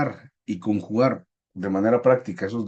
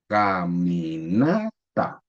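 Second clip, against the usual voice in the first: slow "Caminata", NA stretched and rising, then TA; stress on NA; 6 semitones lower, much slower than usual. Shown as a demonstration. Teacher helping class find the tónica, slow "Caminata" stretched on NA. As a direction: draw out "Caminata" and lift the NA. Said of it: "La sílaba tónica es donde tiene la mayor fuerza".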